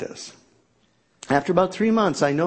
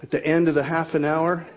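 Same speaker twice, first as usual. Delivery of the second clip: ordinary delivery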